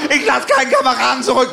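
high, hammed-up soldier's cry; war-movie hero. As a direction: declare it loudly like a comrade in battle. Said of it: "[name], ja, nicht so laut".

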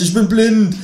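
high, loud cry of panic; shout. Acted. Narrator crying out to the waiter, yelling. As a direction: yell it out, suddenly blinded. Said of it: "schrei ich".

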